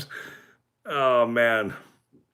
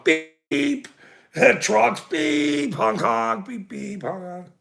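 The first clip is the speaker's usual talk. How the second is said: high chirpy beep and honk noises; playful horn imitation, fading out; imitated horn beeps; 6 semitones higher, slower than usual. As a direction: mimic the trucks honking in passing. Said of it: "imitating the beeping horns".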